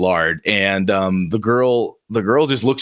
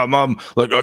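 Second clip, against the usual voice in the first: ordinary talk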